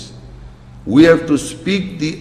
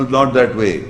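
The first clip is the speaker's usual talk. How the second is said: ordinary talk, nothing marked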